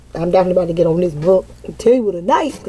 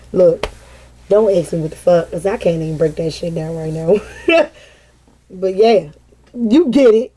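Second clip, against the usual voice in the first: usual speaking voice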